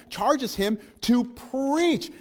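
very slow, each word weighed; slow emphasis. Acted. Preacher repeating the charge slowly to the church, very slow. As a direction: drag it out to drive it home.

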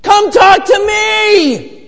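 very high, slow mock shout of a little kid; high drawn-out child's call. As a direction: shout like a five-year-old desperate for a player's attention. Kid calling to players.

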